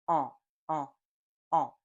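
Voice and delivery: mm sound with some air kept open; half-blocked nasal hum; partly nasal vowel. Shown as a demonstration. Teacher shows the right, partly blocked nasal. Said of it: "pay attention to the mouth shape".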